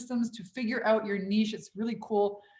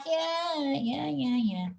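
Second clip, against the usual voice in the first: dismissive yeah; 5 semitones higher, 4 dB quieter, much slower than usual. Retold or imitated. Brushing off the praise.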